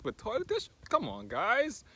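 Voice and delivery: high-pitched disbelief; incredulous. Real, not acted. Vlogger chiding guys for buying toilet tissue, incredulous. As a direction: appeal to the listeners in baffled protest. Disbelief at what people bought.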